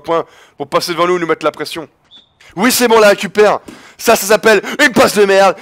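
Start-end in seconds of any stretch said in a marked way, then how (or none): 2.6-5.6 loud, high-pitched crowing; excited gloating. Real, not acted. Gamer mocking the shitty pass he just recovered, excited gloating.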